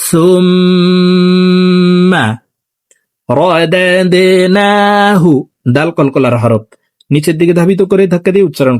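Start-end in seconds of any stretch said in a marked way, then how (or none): 0.1-5.2 slow sung tajweed reading; Quran recitation. Shown as a demonstration. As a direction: chant it slowly with nasal hold. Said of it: "দেখুন আমার সঙ্গে বলুন". (ধীরে তিলাওয়াত করে পড়া)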